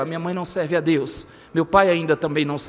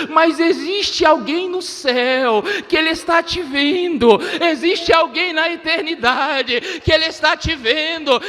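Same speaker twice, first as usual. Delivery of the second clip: preacher working up to a climax; impassioned preaching; 7 semitones higher than usual. Real, not acted. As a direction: build steadily to a high, ringing pulpit shout. Preaches to the church.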